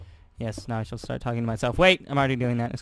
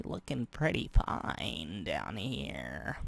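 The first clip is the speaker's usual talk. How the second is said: low slow voice; 7 semitones lower, 8 dB quieter, much slower than usual. Acted.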